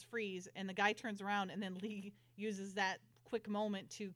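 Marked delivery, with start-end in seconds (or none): none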